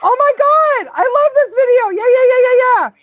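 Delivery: high, loud fangirl excitement; thrilled squeal. Real, not acted. Dog trainer reacting to a favourite video, thrilled.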